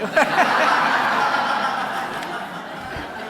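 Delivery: bright burst of real laughter, airy and loud; a real laugh breaking out mid-rehearsal. Real, not acted. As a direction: laugh out at the mix-up. Conductor laughs at misunderstanding.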